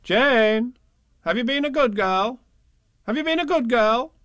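high, loud sing-song question; mock grown-up sing-song; nagging grown-ups. A narrator's reading. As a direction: nag her in a sing-song.

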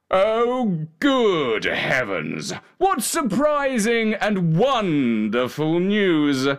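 overjoyed king, long drawn-out words; swooping, stretched royal exclamation; exclaiming king. A narrator's reading. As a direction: exclaim slowly and grandly in delighted astonishment. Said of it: "exclaimed the king".

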